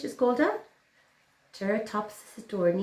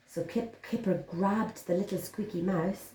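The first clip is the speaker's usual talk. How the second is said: plain reading voice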